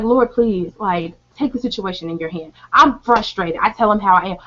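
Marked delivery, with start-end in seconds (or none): 0.0-2.5 soft, sinking prayer; quiet plea. Retold or imitated. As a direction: let it fall into a hushed plea. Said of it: "I literally be like".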